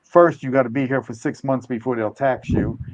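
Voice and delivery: ordinary delivery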